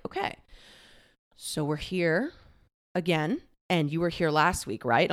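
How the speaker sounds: low, soft, careful therapist voice; gentle therapist. Retold or imitated. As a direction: imitate a therapist gently leading a client. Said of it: "my therapist has so gently".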